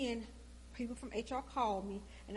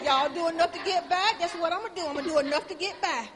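loud, much higher defiant shout; 7 semitones higher, 14 dB louder than usual. Retold or imitated. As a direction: throw it back at them loudly. Telling off coworkers about effort.

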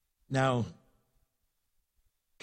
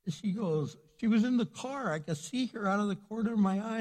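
ordinary talk, nothing marked

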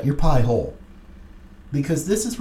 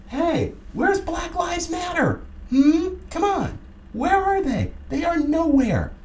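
taunting call-out; 13 semitones higher than usual. Real, not acted. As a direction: call out the no-shows in a high sneering voice. Taunting absent activists.

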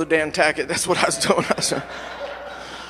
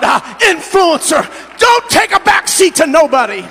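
loud, higher shouted preaching, slow and drawn on the big lines; rally the church, shout it out; full-force preaching; 6 semitones higher, 9 dB louder, slower than usual. Acted. Pastor preaching to the Mother's Day congregation, full-force shouted preaching.

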